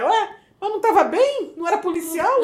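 high, loud indignant questioning; nervous, angry retort. Retold or imitated. Driver confronts the tearful woman she hit, nervous and angry. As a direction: throw it out as an incredulous challenge. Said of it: "eu nervosa e com raiva ao mesmo tempo".

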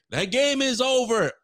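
high, drawn-out mock announcer call; game-over announcement. Announcer declaring the game finished, high dramatic call.

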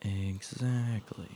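slow breathy whisper. Acted. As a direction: hush it out slowly. Playful whispered echo.

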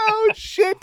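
high breathless laugh on the words; dissolving into laughter; laughing hard. Real, not acted. Host recovering from his own story, still laughing. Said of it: "I'm literally crying real tears".

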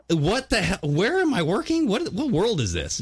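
high, baffled mock voice; high-pitched disbelief; bewildered. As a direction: act baffled at where you work. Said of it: "And so it's like".

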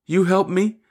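put-on high, rising child's plea; childlike faith. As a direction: ask it like a trusting little child. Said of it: "with childlike faith".

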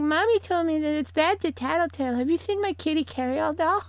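put-on high, lispy little girl voice; lisp. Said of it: "The one with the little lisp".

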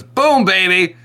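high, cocky grand-entrance shout; Kuzco. Retold or imitated. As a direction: burst in showing off. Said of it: "Kuzco goes through, through the door and he goes".

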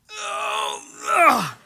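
groan bending over. Retold or imitated. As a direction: play the aching body tying shoes. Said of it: "it is just this".

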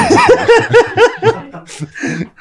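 shrieking high laughter. Real, not acted. Laughs at own slip.

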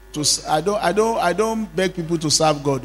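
ordinary talk, nothing marked

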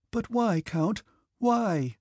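high-pitched, urgent pleading; almost crying out. A narrator's reading. Princess begging the count for his reason, almost crying out. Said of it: "she almost cried".

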